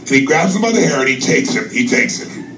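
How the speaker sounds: usual speaking voice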